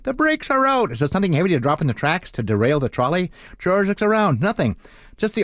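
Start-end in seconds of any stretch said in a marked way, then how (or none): 0.0-0.9 high-pitched panicked cry without extra volume; mock scream. Trolley driver crying out that brakes failed, high mock scream. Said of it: "George hears the driver screaming".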